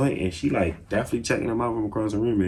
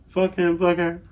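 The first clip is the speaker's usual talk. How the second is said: dismissive; 10 semitones higher than usual. Real, not acted. Dismissing Doc and niece.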